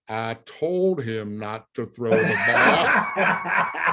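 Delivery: very slow, loud country drawl from under the pile; slow Southern drawl, called out from the bottom of the pile. Retold or imitated. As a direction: stretch every word like a slow Southern lineman. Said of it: "in a slow Southern drawl".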